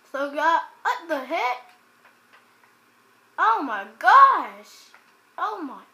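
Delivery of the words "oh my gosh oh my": loud, slow, falling groan of dismay; slow loud dismayed exclamation; drawn-out exasperated oh my gosh. Real, not acted. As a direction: stretch it out, fed up. Overwhelmed by mobs.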